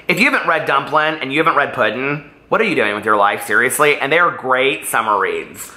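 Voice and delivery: usual speaking voice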